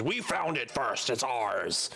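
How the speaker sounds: goblin insisting. Acted. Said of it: "says exactly that".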